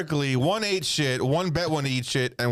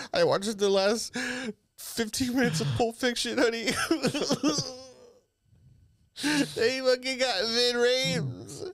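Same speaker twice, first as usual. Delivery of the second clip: mock excited husband; 8 semitones higher than usual.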